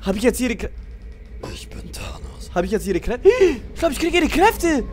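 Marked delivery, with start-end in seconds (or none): 1.5-2.5 demonic whisper. Acted. Claims villain identity, whispered.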